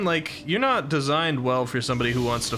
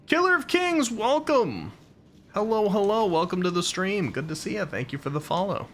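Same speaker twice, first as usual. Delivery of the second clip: high cheerful welcome to the stream; bright stream greeting; 8 semitones higher than usual. Real, not acted. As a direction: greet the new follower warmly and high. Greeting new stream follower.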